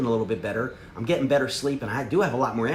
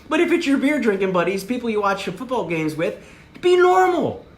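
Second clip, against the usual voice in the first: much higher and louder on be normal; emphatic shout; 8 semitones higher, 6 dB louder than usual. Real, not acted. Coach yelling the key advice to the room, loud. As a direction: hammer the point home.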